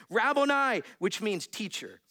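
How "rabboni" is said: loud, higher-pitched exclamation; crying out. Acted. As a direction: cry it out in sudden recognition. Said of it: "cried out in Aramaic".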